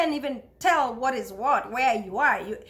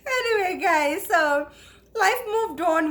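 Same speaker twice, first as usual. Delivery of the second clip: laughing through tears; 6 semitones higher, 4 dB louder than usual. Real, not acted. Laughing, tearful after story.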